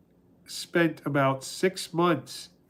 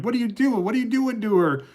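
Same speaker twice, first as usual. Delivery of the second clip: mock yelling; 7 semitones higher, faster than usual. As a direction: imitate an indignant husband yelling at his wife. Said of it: "started yelling at his, his wife".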